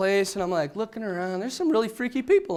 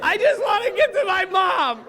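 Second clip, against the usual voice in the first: laughing refusal; 11 semitones higher than usual. Retold or imitated. To the drunks beating on the door, a laughing much higher no. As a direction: cry no while cracking up.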